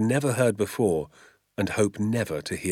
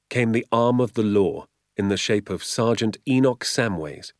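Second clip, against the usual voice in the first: usual speaking voice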